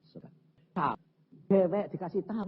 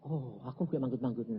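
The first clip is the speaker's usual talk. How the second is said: ordinary talk, nothing marked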